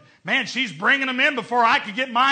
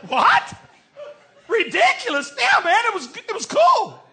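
pitched way up, hammed-up kid talk; high, louder mock youth slang; mock excited youth; 12 semitones higher, 5 dB louder than usual. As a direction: play an eager teenager gushing.

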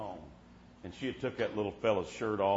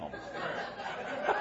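soft laugh; 4 dB quieter than usual. Real, not acted. Laughing at own joke.

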